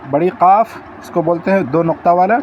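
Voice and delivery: ordinary talk, nothing marked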